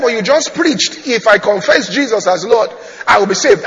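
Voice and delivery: loud, high-pitched protest. Retold or imitated. Man quotes the sermon back at the pastor, protesting. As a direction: argue back, acting out the indignant convert.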